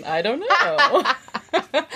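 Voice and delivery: drawn-out, slow and laughing; teasing laugh through the words; coy laugh. Real, not acted. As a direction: play coy while giggling. Coy answer to teacher question.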